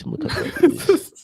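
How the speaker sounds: very high, laughing squeak; high laughing squeal. Real, not acted. Laughing at the joke gun.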